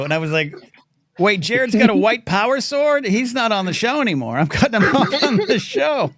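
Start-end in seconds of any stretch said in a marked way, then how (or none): 1.2-6.1 high, loud shocked disbelief, breaking into laughter; mock outrage. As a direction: act scandalized, louder and much higher. Acted shocked reaction to misread comment.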